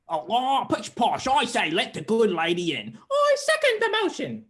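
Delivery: put-on posh puppet voices, leaping to falsetto; hammed-up cartoon gentry; pompous puppet characters. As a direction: play blustering puppets at a tea-party debate. Puppets debate at tea party.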